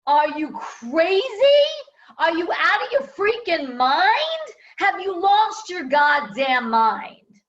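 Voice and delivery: high, loud furious scolding; mom yelling. Retold or imitated. Mom scolds her daughter about the job, yelling. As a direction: yell it at her like a shocked mom. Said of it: "she was like".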